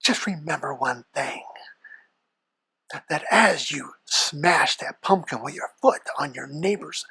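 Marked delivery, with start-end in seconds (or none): 0.0-1.4 sinister hushed rasp; hushed devil voice. Acted. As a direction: keep it low and secretive like the devil. Devil warning.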